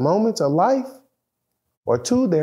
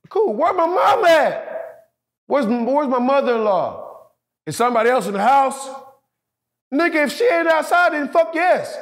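frantic; 8 semitones higher than usual. Retold or imitated. Frantic search for family.

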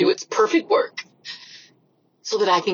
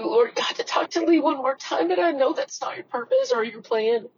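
high, crying plea to God; tearful prayer; 6 semitones higher than usual. Real, not acted. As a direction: pray through tears, voice pitched up. Tearful prayer to God about loss.

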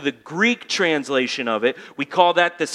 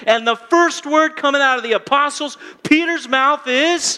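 building voice; 7 semitones higher, 3 dB louder than usual. Shown as a demonstration. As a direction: climb steadily higher and louder toward the climax. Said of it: "You notice my voice is going up".

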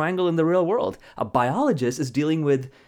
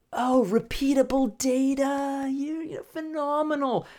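gushing praise; 12 semitones higher, slower than usual. Acted. Awed onlooker praising repeat result.

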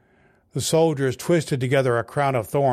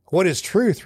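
retort; 6 semitones higher than usual. A narrator's reading. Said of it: "retorted Pilate".

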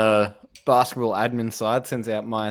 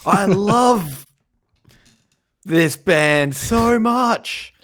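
mock fan scream; 9 semitones higher, 5 dB louder, much slower than usual.

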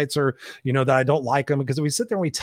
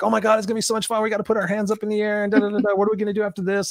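higher and fast, gushing mock thrill; excited chatter; 7 semitones higher, faster than usual. Riders in line before the coaster, mock giddy excitement. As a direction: imitate giddy riders bubbling over. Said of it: "The group in front of me was excited".

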